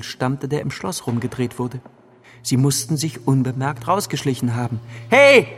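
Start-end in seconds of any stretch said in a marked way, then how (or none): 5.1-5.5 much higher, louder yell; shout. A narrator's reading. Locked-in boy yells as the key turns, panicked shout. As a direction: yell after them in panic. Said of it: "schrie ich".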